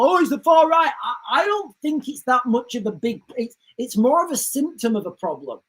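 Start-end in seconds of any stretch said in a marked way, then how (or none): 0.0-0.9 mocking quote. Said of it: "a lot of people".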